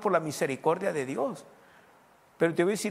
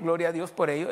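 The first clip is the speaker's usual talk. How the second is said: normal talking voice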